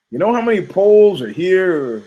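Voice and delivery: normal talking voice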